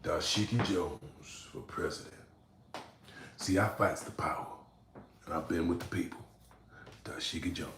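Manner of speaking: a hint of a pompous activist speech; mock campaign slogan, lightly voiced; low-budget activist. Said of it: "I sound like a low-budget political activist".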